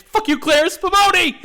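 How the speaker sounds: high, loud hammed-up yell; mock yell. Retelling Jackie yelling at the referee Clarence, mock yell. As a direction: scream it at the referee. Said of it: "And Jackie yells".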